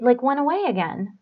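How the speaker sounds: ordinary talk, nothing marked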